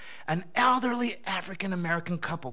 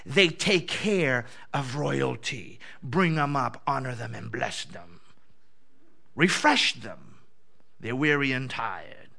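much lower, slow, solemn divine voice; deep slow voice of the Spirit giving orders; voice of the Lord; 9 semitones lower, 4 dB quieter, slower than usual. Retold or imitated. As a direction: speak as God, low and unhurried. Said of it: "the Spirit of the Lord speaks to me and says".

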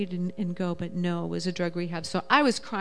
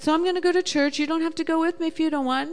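timid wife; 8 semitones higher, much faster than usual. Retold or imitated. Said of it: "And I said".

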